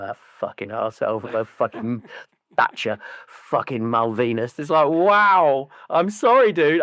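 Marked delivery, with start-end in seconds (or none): 0.0-4.6 grumbling neighbour quote. Retold or imitated. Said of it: "he's like".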